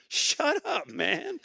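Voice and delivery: high irritated brush-off; mock snap to be left alone; annoyed. Pastor's inner voice telling the friend to go away, mock annoyed. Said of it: "In other words, I'm going".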